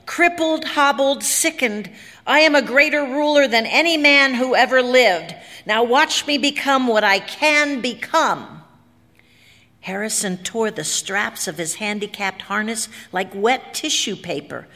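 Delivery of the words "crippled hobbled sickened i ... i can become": high, forceful boast of supremacy; bellowing. A narrator's reading. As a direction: declaim it defiantly at full voice. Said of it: "he bellowed".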